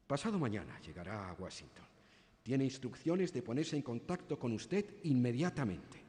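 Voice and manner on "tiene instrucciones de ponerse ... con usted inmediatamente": ordinary delivery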